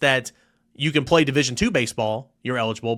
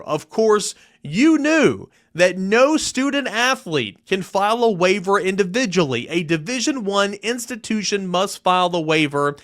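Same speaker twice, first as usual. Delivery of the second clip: higher, slower, each clause pressed hard; added emphasis; 6 semitones higher than usual. Shown as a demonstration. Reading the player's letter aloud to listeners, emphatic. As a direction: read the accusation with weight. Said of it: "Trying to add emphasis".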